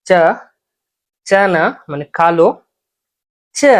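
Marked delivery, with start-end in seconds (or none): 3.6-3.7 "চা" said forcefully, with a firm push; জোরালো চা. Shown as a demonstration. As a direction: punch out the sound "চা". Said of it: "জোরে বলতে হবে". ("চা" জোর দিয়ে বলা)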